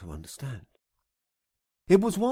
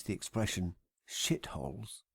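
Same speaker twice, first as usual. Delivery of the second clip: conspiratorial whisper; whispered aside; 6 semitones lower, 9 dB quieter than usual. Acted. Narrator confiding a rude word to readers, whispered. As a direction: lean in and whisper it.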